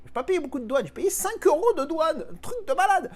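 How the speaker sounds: rising into the high notes in disbelief; high-pitched. Real, not acted. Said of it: "je pars dans les aigus quand je dis un truc comme ça".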